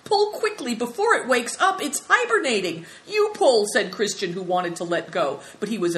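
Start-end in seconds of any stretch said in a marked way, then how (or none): 0.0-2.9 high, loud, urgent; gasping excitement. Acted. Big Tiger pulling a sleeping animal out, urgent gasping. 3.1-3.6 high, loud retort; alarmed protest. Acted. Frightened boy wants to let go.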